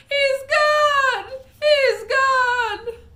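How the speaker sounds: very high, very loud shriek; screaming. Acted. Mother shrieks at the empty spot where her son was. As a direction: scream it in horror. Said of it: "she screamed".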